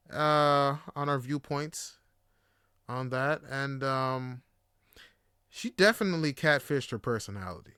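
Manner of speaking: usual speaking voice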